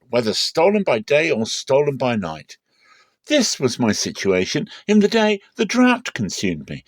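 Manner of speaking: high, loud indignant protest; angry Jacob. A narrator's reading. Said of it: "Jacob was angry and argued with Laban".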